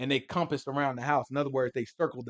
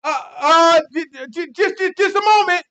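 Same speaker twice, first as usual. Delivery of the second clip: high, loud stuttering panic; nervous stammer; 13 semitones higher, 11 dB louder than usual. Retold or imitated. Lot stammering at the mob outside his door. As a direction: stumble over every word in a fluster. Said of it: "Lot look at him like".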